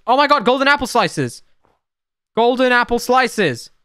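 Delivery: high, loud thrilled cry; excited. Real, not acted. Gamer finding rare golden apples, high thrilled cry. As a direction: burst out with delight at the loot.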